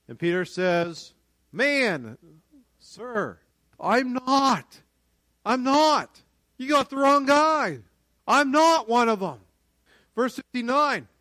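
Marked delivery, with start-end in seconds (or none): 1.5-9.4 high, loud protest; insistent denial. Acted. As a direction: protest forcefully against an accusation. Acted denial by accused Peter.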